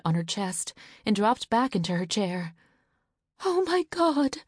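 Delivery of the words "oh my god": much higher cry of dread; despair. Acted. Mother dropping into her chair, high cry of despair. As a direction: let it break out of her as she sinks.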